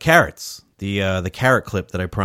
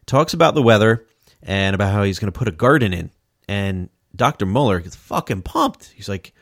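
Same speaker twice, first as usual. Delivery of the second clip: ordinary talk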